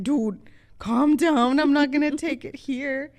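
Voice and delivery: loud, high, fed-up protest; exasperated loud pushback at boyfriend; exasperated retold shout. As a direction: snap back at him loudly to calm down. Telling boyfriend off about test.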